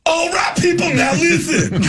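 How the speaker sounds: high, slow shouted stage banter. Retold or imitated. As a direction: belt it like a rock frontman. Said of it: "[name] gave a stage rap".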